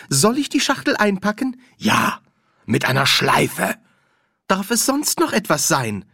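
high, bright salesy voice; eager shopkeeper. Acted. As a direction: sell it sweetly. (hohe, eifrige Verkäuferinnenstimme)

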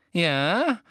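high, eager rising "yes?"; suddenly excited. A narrator's reading. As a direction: perk up with sudden keen interest. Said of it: "mit jäh wiedererwachter Erregung".

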